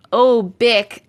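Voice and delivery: ordinary delivery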